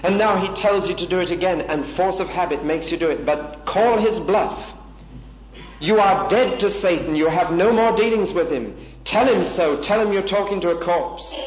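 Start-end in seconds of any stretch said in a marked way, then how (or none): 3.3-11.4 rousing call. Real, not acted. Preacher urges defying Satan.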